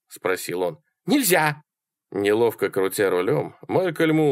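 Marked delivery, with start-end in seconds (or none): 1.1-1.6 high-pitched abrupt refusal; high female character voice; Sally refusing. A narrator's reading.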